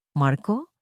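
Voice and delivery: ordinary delivery, nothing marked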